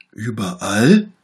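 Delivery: drawn-out astonished question; surprised little bear. A narrator's reading. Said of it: "fragte der kleine Bär".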